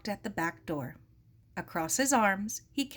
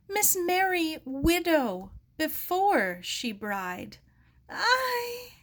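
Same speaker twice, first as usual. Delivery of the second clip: higher, slow, near tears; tearful; 10 semitones higher, much slower than usual. A narrator's reading. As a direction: grief catching in the throat. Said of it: "his eyes filled with tears".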